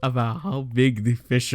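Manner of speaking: high, loud, drawn-out disbelief; exasperated outburst. Real, not acted. Telling how she just would not stop, exasperated. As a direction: stretch the words out in incredulous exasperation. Exasperated storyteller at story's peak.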